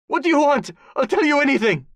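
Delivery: high, loud, frantic offer; desperate pleading. A narrator's reading. Tortured warlord offers to talk, pleading frantically. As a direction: plead as if about to be hurt. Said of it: "Mombasi pleaded".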